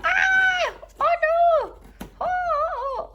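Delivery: high, loud alarmed oh no; startled cry. Acted. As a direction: jump at the big lion. Lion scare in story.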